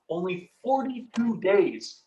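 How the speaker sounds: "Only forty-two days" slow, every word stretched; extended words. Shown as a demonstration. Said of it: "he extends the words".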